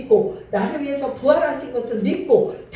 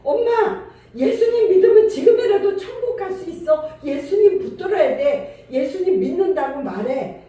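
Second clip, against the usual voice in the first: high, loud, insistent urging to confess faith; urgent pleading to mother; 5 semitones higher, 5 dB louder than usual. Retold or imitated. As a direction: push her to say it before it's too late. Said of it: "이렇게 말을 해야 되는 거예요".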